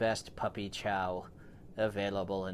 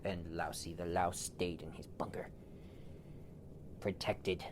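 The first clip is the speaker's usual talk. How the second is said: whispered narration; 3 semitones lower, 7 dB quieter than usual. A narrator's reading.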